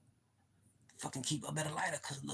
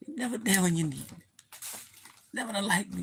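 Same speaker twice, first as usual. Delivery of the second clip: scolding the lighter; 5 semitones higher, 7 dB louder than usual. Pretend.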